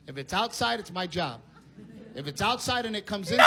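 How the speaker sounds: usual speaking voice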